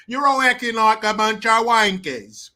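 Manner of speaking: loud imitation of an Australian student; mock Australian accent. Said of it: "foreign exchange student from Perth".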